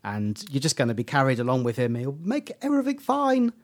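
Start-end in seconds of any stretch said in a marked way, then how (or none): 2.0-3.5 climbing into a very high voice; high-pitched playful gushing; mock delight. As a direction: lift it to a squeaky high finish. Acts out delighted faith in Doctor.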